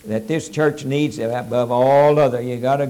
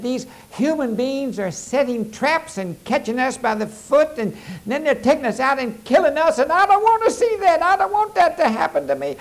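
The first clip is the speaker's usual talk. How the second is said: high, anxious little coon voice, rising higher; a high, worried critter pleading about the traps; frustrated female coon; 10 semitones higher than usual. Acted. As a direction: play the fretful lady raccoon, close to tears. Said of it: "she's so frustrated".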